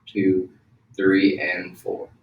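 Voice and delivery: slow, drawn-out count; slow breathing count. Shown as a demonstration. Teacher guiding the exhale, slow and drawn out. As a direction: stretch the count as the breath runs out. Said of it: "Breathe out".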